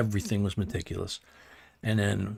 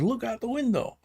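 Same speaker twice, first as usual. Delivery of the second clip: way higher, eager and teasing; excited old man; 14 semitones higher than usual. Retold or imitated. Old man on the car phone telling great grandma to look outside, high and excited. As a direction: urge her to look, full of glee. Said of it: "He goes".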